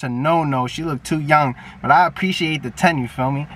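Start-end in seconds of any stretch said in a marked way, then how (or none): none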